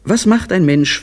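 high, quick reproachful voice; reproachful wife. A narrator's reading. Wife reproaches her husband over lunch excuses, high and quick. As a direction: throw his own words back at him.

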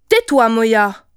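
loud angry order, falling at the end; sharp interruption. A narrator's reading. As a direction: cut the child off. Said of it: "l'interrompit Kijivu". (ordre sec et furieux)

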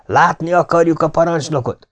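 high-pitched, insistent shout; narrated roar. A narrator's reading. Palmer demanding to see the commander, shouting. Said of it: "üvöltötte Palmer".

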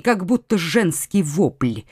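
ordinary delivery

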